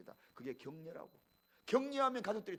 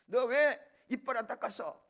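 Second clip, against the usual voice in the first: loud, raised-pitch mock telling-off; scolding mother; 5 semitones higher, 13 dB louder than usual. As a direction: play a mother angrily demanding an answer. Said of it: "우리 한국 사람은 어머니가 질책하지만".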